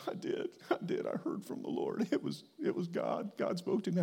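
choked, hushed tearful voice; imitated sobbing. Weeping man tells preacher he heard God, mock sobbing. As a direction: imitate a man barely getting words out through sobs. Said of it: "he is just sobbing".

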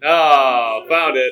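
loud pleased oh, found it; excited discovery. Pretend. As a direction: burst out with the find. Finds the signal.

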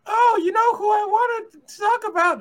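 high shouted squeaky voice; Moses voice. Retold or imitated. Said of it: "I misrepresented you poorly".

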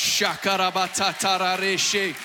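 rapid tongues burst, fervent; speaking in tongues. Shown as a demonstration. Preacher showing moms praying in tongues, fervent burst. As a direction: let the tongues pour out.

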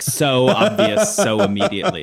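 ordinary delivery

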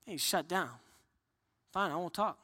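low, soft sulky pout; mock sulk, muttered low. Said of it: "You pout".

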